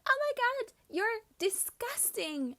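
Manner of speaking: high, loud shriek of disgust; shrieking mock outrage; imitated scream. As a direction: scream it like an appalled schoolgirl, drawing out the insult. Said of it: "starting to scream".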